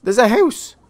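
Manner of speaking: high, stunned exclamation; amazed. Real, not acted. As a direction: squeal it in astonishment. Amazed at underwater house.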